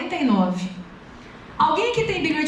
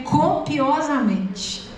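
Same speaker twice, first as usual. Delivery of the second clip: very slow, stretched-out delivery; savoured fancy word; much slower than usual. Real, not acted. Showing off a chic word to the congregation, slowly savoured. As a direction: draw each syllable out for effect.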